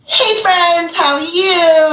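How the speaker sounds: high, chirpy hello to the room; bubbly greeting. Retold or imitated. Imagining her entering a location, high chirpy greeting. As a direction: sing out a bright hello to friends. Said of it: "you're like".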